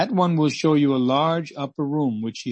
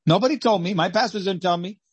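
anguished cry; 7 semitones higher, 4 dB louder than usual. Acted. Voicing a soul in hell.

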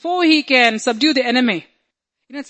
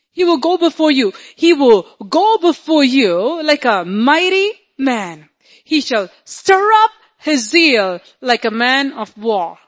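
loud, higher declamation of scripture; full-force preaching; 3 semitones higher, 5 dB louder than usual. Acted. Preacher declaring a mighty warrior God to the congregation, full force. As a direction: proclaim it with swelling power.

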